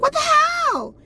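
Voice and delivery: high-pitched, imitated outraged yell; outraged shriek. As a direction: shriek it like she just burst in. She bursts in on his call.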